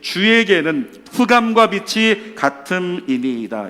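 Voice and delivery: slow, loud, emphatic recitation; scripture reading. Real, not acted. Preacher reading verse twelve with the congregation, declaimed slowly. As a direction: lead a congregation reading aloud, deliberately. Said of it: "십이 절 시작".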